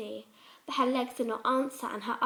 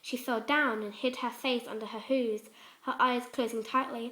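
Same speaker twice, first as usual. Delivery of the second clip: ordinary delivery, nothing marked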